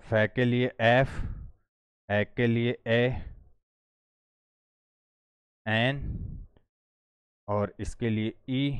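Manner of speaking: slow, drawn-out dictation of each sound. Shown as a demonstration. Teacher dictating symbols while writing them, slow. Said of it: "इनके बीच में ही इनका pronunciation लिखना है".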